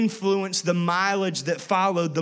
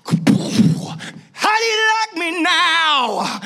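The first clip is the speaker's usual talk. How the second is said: long, high, drawn-out taunting yell; hammed-up victorious show-off roar; gloating shout; 9 semitones higher, 5 dB louder, much slower than usual. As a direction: holler it in triumph, stretching every word. Imagined gloating return at Pilate's house.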